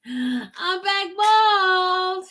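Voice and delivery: very high, very slow and stretched; drawn-out sing-song. Real, not acted. Repeats the reveal, drawn out.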